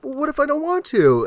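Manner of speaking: squeaky mock challenge; high goofy voice. Recruiter pushing back, high and silly.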